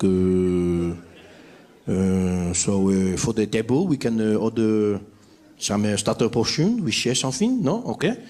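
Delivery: put-on low, halting French-accented English. French diner ordering South African food, low French accent. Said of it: "no French people sitting in our restaurants in South Africa".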